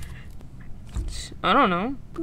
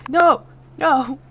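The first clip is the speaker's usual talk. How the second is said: loud protesting no; shouted no; 5 semitones higher, 9 dB louder than usual. Real, not acted. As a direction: shout it in real dismay. Reacts to being voted out.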